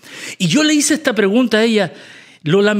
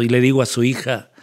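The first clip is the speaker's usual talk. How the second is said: ordinary talk, nothing marked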